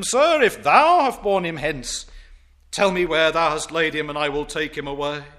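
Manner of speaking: Sir if thou have borne him hence, cried out loud; loud plea. Acted. Mary pleading loudly with the gardener for the body. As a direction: call out to the stranger, pleading.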